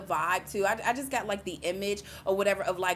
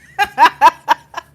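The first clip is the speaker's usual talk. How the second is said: big loud laugh, high; loud burst of laughter; 9 semitones higher, 21 dB louder than usual. Real, not acted. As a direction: let out a loud, real laugh. Laughing at wanting to punch him.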